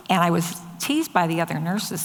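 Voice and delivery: ordinary talk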